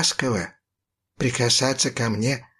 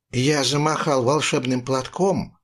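plain reading voice

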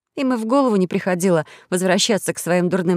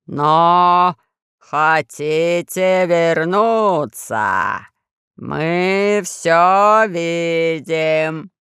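низкий хриплый, очень медленно; медленно, по слогам, хрипло; low hoarse voice; 3 dB louder, much slower than usual. A narrator's reading. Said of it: "рычал в микрофон Пашка". (низким хриплым голосом, по слогам)

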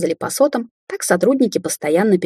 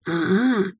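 knowing; 4 dB quieter than usual. A narrator's reading. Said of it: "понимающе отозвался Сапфир".

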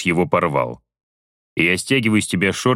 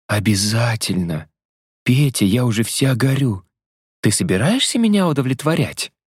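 higher female voice, mock passion; hammed-up impatience; teasing woman; 4 semitones higher than usual. Vasya teasing.

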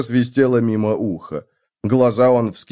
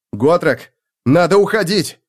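calling out; 6 semitones higher, 3 dB louder than usual. Acted. Felix calls out amid collapse.

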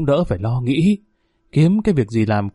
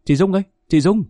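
calling out; 6 semitones higher, faster than usual. A narrator's reading. Man calling neighbour from outside the gate, calling out. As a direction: call to a neighbour for attention. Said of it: "Tuấn gọi".

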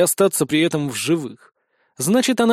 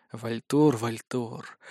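rueful self-reproach; 6 semitones lower, 7 dB quieter, much slower than usual. A narrator's reading. Narrator reproaches himself.

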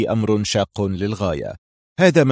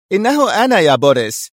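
higher-pitched character voice over narration; woman's voice; 8 semitones higher, 4 dB louder than usual. A narrator's reading. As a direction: announce yourself, pitch lifted high. Mira announces herself by radio.

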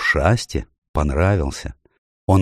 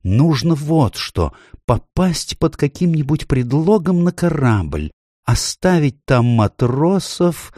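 high faun voice, drawn out; scheming faun; 8 semitones higher, slower than usual. A narrator's reading. Faun explaining his ruse to the king, high-voiced. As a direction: lay out the plan in a high voice. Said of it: "остановил его Фавн".

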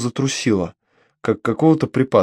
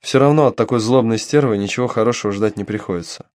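usual speaking voice

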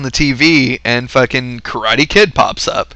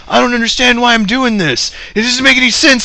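put-on high, whiny young voice; Daniel-san whine; 11 semitones higher than usual. Daniel-san complains to Mister Miyagi, high whine. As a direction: whine like a frustrated pupil.

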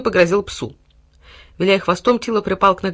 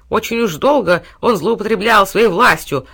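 angry pig grunt; 4 semitones higher, 6 dB louder than usual. A narrator's reading. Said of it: "злобно хрюкнула свинья".

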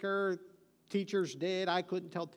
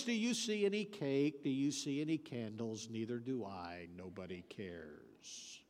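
Eeyore; 9 semitones lower, 7 dB quieter than usual. Acted. Gloomy donkey nobody celebrates, moping about cake and candles. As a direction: let each phrase droop into a low, soft mope. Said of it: "It's Eeyore".

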